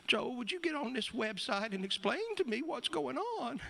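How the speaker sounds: high-pitched imitation of an upset mother; upset mom. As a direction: play mom calling her son, upset, voice pitched high. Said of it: "I could tell she's upset".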